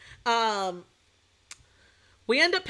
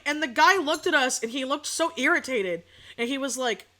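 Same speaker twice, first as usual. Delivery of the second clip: ordinary delivery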